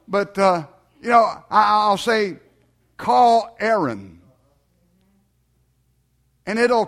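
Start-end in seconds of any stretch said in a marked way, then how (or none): 3.0-4.2 slow, deliberate command to a machine; careful voice command. Retold or imitated. Preacher telling his car whom to phone, slow careful command. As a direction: space it out for the car to understand. Re-enacts command to car's voice system.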